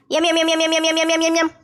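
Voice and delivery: rapid, even "myem-myem" chomping; munch aloud in a steady chant; munching noises. Acted. Mother-in-law tasting the tomato thokku, cartoonish munching.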